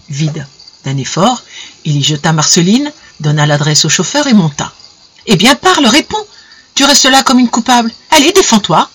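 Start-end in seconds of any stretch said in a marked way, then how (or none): 5.3-8.9 high, urgent demands; impatient, raised-pitch badgering; angry interrogation. A narrator's reading. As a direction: press hard for an answer. Husband interrogates wife angrily.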